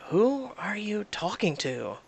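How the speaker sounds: usual speaking voice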